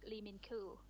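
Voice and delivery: ordinary talk, nothing marked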